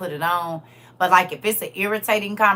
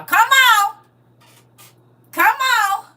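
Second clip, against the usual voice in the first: much higher and louder, egging on; excited shout; 11 dB louder, much slower than usual. Real, not acted. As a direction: cheer at the comment. Excited reaction to comment.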